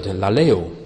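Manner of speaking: "laleo" said as Greek, la-LE-o; Greek word. Shown as a demonstration. Teaching the Greek verb "laleo", said carefully in Greek. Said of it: "one particular Greek word".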